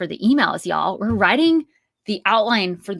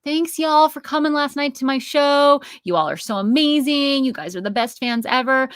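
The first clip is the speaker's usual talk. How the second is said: higher, gushing shout-out to the whole fan base; gushing thanks to crowd; 7 semitones higher, 4 dB louder than usual. Retold or imitated. Musician thanking a whole crowd after a show, gushing. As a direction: thank everyone at once like from a stage. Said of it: "You don't wanna say, like".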